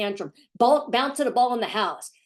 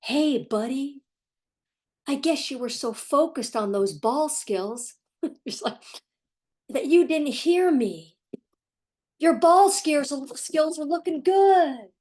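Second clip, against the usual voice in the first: higher, slow, sugary coaxing; mock gentle parent; 5 semitones higher, slower than usual. A parent mocks expert-style coaxing of a child, high and slow. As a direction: coo at the kid like the experts advise. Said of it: "now you're gonna connect from his perspective".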